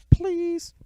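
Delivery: very high, squeaky begging; mock pleading. [name] begging for one final delivery, high mock plea. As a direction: beg in a squeaky put-on voice.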